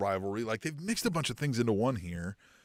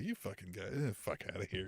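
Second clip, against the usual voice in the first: mock low dismissive grumble; 6 semitones lower, 14 dB quieter than usual. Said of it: "he was kinda like".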